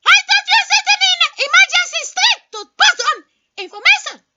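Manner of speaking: fast, loud, high shouting with a laugh. Acted. Caller defending his shouting, loud and fast. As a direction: yell it proudly as if normal. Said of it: "when you shout like that".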